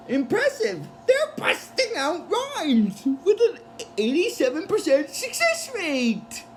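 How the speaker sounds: high, swooping, drawn-out amazement; mock impressed. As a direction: put on an exaggerated impressed voice. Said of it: "Leela gets impressed with them".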